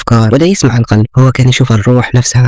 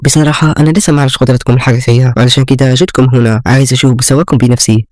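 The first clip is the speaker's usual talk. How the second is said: plain reading voice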